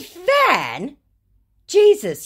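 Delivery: sharp high incredulous yelp; outraged disbelief. Real, not acted. Narrator stunned the scammer called her a fan. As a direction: spit the word back in disbelief.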